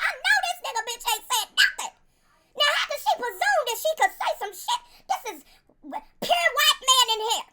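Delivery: high, indignant mock voice of the master, laughing; Beelzebub's inner voice. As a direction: play the outraged master's private thoughts. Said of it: "Beelzebub looked at her like".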